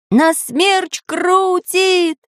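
high, very slow drawn shout. A narrator's reading. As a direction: stretch the cry. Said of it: "ещё громче кричал Самоделкин".

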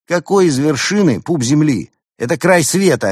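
loud, high-pitched objection; indignant contradiction. Acted. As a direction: argue back, scandalised. Friend contradicts the other's boast.

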